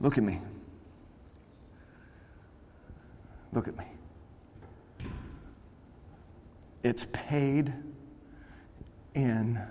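very soft, spaced-out words; quiet, grave insistence; hushed appeal. Real, not acted. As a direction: drop right down and let it sink in. Hushed appeal after the shouting.

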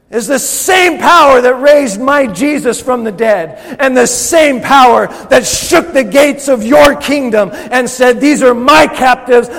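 high, intense full-force shouting; impassioned, very loud preacher's roar; angry-sounding preaching. Real, not acted. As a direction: thunder defiance at the enemy. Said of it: "I sound angry".

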